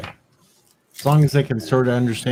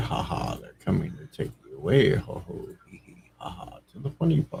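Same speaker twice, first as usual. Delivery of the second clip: whispered laugh; 6 semitones lower, 9 dB quieter than usual. Acted. Sings the crazy song.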